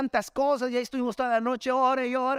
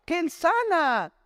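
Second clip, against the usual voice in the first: slow, higher, a touch of smug certainty; drawn out like someone showing off what he thinks he knows; a preacher mimicking a boastful believer, slow and higher; mock proud claim; 6 semitones higher, slower than usual. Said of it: "yo puedo decir".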